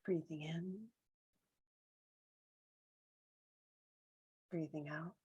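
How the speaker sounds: calm meditation voice, long silences between phrases; guided meditation. Real, not acted. Guide counting breaths with listeners, calm and spacious meditation voice. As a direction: leave room for each breath, gently.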